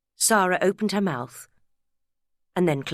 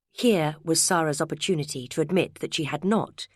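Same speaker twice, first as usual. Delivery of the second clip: ordinary delivery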